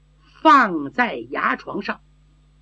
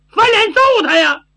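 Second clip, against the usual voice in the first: high, loud, simple-minded bravado; belligerent urging; 11 semitones higher, 5 dB louder than usual. Acted. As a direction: urge a fight, loud and high. 傻丫头主张动手. (傻气十足地高声叫打)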